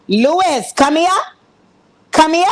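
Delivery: high, rising come here; bosses calling Lewis. Retold or imitated. Management summoning Lewis, high rising call. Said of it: "upper management looked at Lewis".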